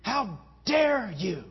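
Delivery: slow, loud, high outrage; very angry. Retold or imitated. Man retorting to the preacher's counsel, very angry. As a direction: draw it out like an offended man. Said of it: "he got very angry".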